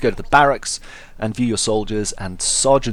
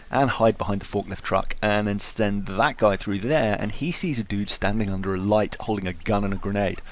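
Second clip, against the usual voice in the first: ordinary talk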